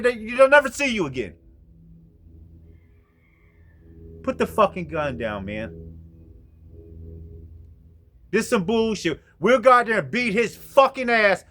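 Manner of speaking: loud high enraged yelling; loud fight over the gun; furious shouting. Retold or imitated. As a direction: scream him down. Shouting match with armed friend.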